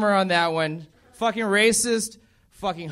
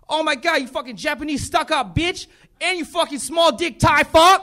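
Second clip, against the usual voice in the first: higher mock tirade building to a shout; hammed-up coworker insulting the couple; coworker's outburst; 7 semitones higher, 4 dB louder than usual. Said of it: "He's like".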